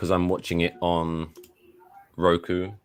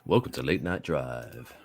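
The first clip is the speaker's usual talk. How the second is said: lower, slow radio DJ with a laugh; late-night radio host; 4 semitones lower, 6 dB quieter, slower than usual. Pretend. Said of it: "Little bass".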